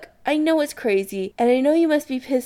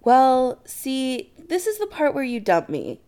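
higher, halting, mildly apprehensive; 7 semitones higher than usual. Acted. Chris confessing to Adam, apprehensive. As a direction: lead into it uneasily. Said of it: "he says apprehensively".

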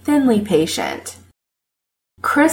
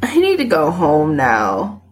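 usual speaking voice

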